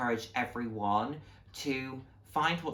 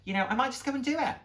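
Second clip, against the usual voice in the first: high, eager and bright, building to a loud finish; lively retold chat with a colleague; excited retelling; 8 semitones higher, 3 dB louder, faster than usual. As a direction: tell her your plan with rising excitement. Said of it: "I said".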